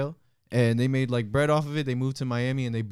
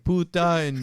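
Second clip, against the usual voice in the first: ordinary delivery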